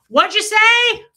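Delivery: loud, high call over the water; shouted phone reply. Retold or imitated. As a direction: yell it into a phone from the shower.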